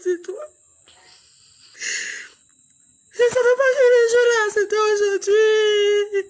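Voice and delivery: high sobbing wail, loud and breaking; wailing in tears. Real, not acted. Mother at her sick baby's bedside, wailing in tears. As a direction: cry the words out, grief overflowing.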